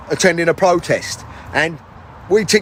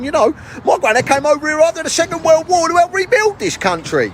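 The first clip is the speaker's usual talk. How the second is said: much higher, worked-up bloke rant; indignant high mate; 9 semitones higher than usual. Acted. High character rants about granddad.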